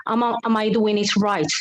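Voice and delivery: ordinary delivery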